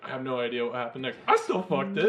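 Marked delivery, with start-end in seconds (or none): 1.3-2.1 high, loud mock blurt; hammed-up proud confession; gleeful confession. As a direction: shout the punchline as an imitation. Repeating the retold punchline.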